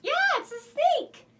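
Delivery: high, loud cheer for a right guess; excited confirmation. Pretend. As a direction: celebrate the children's answer. Cheers children's correct guess.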